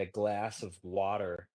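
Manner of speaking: glass of water with a flat American a and an r on water; slow, drawn American glass of water; American accent. Retold or imitated. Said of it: "In America, you guys say".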